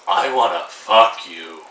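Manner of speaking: low, slow, mock-seductive; hammed-up low Grey voice. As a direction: drop down and drag it out like a bad seducer. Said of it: "just doing that impression".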